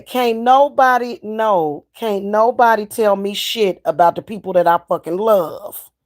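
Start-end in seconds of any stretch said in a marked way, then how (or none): none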